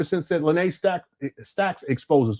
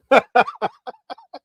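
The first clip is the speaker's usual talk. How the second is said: laughing; 9 semitones higher, 4 dB louder than usual. Real, not acted. Burst of laughter.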